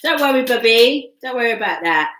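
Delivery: excited. Real, not acted. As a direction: blurt it out excited. Excited reaction to card.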